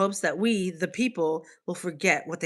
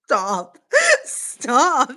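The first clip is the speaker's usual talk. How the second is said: laughing stop, higher and louder; laughing protest; 5 semitones higher, 6 dB louder than usual. Real, not acted. Teased by chat about Aussie fans, laughing protest. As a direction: giggle it out at the teasing.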